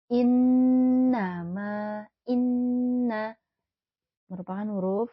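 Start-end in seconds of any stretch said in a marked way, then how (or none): 0.1-3.4 slow, drawn-out, louder chant; slow melodic recitation; Quran recitation. Shown as a demonstration. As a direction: hold the nasal "nn" as in tajwid. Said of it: "recitation demo".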